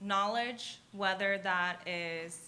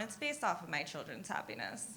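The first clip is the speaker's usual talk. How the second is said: ordinary talk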